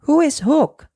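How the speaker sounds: high startled question; cried question. Acted. John asking Peter in alarm, high startled. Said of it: "cried John".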